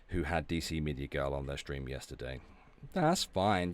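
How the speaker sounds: ordinary talk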